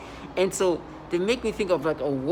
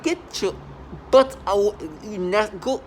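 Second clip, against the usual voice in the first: higher, heated order to leave; angry dismissal; 4 semitones higher, 3 dB louder, slower than usual. Acted. Telling people to get out and go, angrily. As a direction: chase them off, voice pushed up. Acts out chasing them away.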